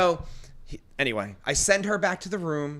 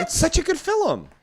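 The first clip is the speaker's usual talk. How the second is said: high-pitched protest. Real, not acted. Defending the movie she never watched, high-pitched protest. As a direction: squeal it very high. Mock outrage at unseen movie.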